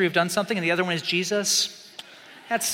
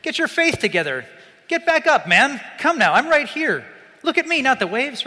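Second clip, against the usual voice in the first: mock-stern Jesus scolding Peter, higher and louder; stern rebuke; 7 semitones higher, 6 dB louder than usual. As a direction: scold him like a disappointed coach, hammed up. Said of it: "Jesus crosses his arms and frowningly, sternly".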